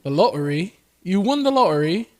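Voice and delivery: "lottery" with a glottal stop; British dropped T. Shown as a demonstration. Echoing British speech, "lottery" without its T. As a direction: swallow the T in "lottery". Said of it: "they don't say their T's".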